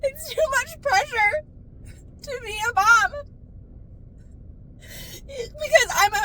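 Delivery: high, slow tearful wail; sobbing. Acted. Confessing the pressure of being a mom, sobbing. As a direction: cry through each phrase, pitched very high. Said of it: "I'm probably gonna cry".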